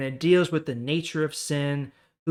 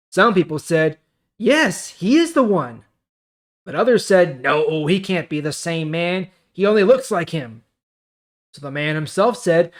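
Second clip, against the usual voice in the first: louder, clear Bible reading voice; scripture reading; 3 semitones higher, 8 dB louder than usual. Acted. YouTuber reading John chapter nine to his viewers, projected scripture reading. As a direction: read the chapter out like a preacher.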